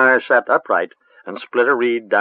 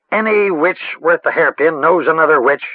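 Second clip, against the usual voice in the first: impatient; 7 semitones higher, 4 dB louder than usual. A narrator's reading. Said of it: "plainly, impatiently".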